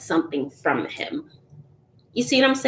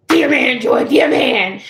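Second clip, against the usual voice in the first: loud exclamation; 4 semitones higher, 7 dB louder than usual. Real, not acted. Reacting to viewer comment.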